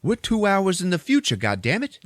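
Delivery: high, disbelieving exclaim; stunned realization. Retold or imitated. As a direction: realize you've lost two hours. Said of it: "He's like".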